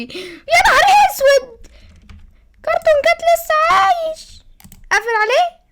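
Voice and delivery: very high, slow panicked wail; high drawn-out scream of fright; terrified squeal. Acted. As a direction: shriek it out in cartoonish horror. Uncle Sayed terrified of cartoon cat.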